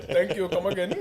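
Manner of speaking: put-on a light Apu-style Indian accent; Simpsons shopkeeper accent. Joking friends quote the Simpsons shopkeeper, light Indian accent. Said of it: "I did approve from Simpsons".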